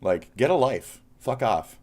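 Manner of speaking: loud, high dismissal; angry shout. Real, not acted. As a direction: tell her off with real heat. Ranting at online critic.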